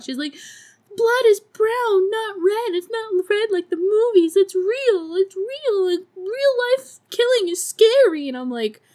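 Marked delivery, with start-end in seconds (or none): 1.0-8.3 high-pitched, hammed-up panic; mock freak-out. Girl shocked that killing is real, mock freak-out. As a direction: wail it as if losing it. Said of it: "freaks out".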